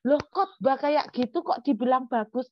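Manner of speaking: baffled that anyone praised it; a startled "how is that good?"; incredulous. Real, not acted. Preacher's disbelief at the praise.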